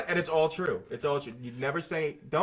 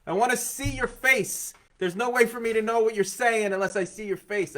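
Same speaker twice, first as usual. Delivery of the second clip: high, loud, exasperated complaint; mock outrage, pitched way up; indignant old-timer; 10 semitones higher, 6 dB louder than usual. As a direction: protest loudly as an old-fashioned grumbler. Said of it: "sixty years ago, people would be like".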